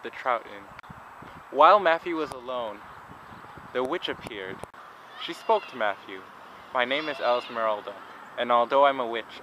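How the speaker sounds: playful fairy-tale narration; story narration. Acted. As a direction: tell it like a bedtime tale. Reads a story to viewers.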